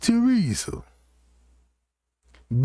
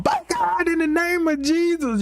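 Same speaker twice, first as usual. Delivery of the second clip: very high, wailing mock scream; Miss Teresa screaming. Beaten cellmate calling on God, imitated screaming. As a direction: shriek out a desperate plea to heaven. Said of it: "screaming at the top of her lungs".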